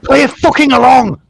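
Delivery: high, loud shouted order; impatient yell. Real, not acted. Host yelling to play it along, impatient shout.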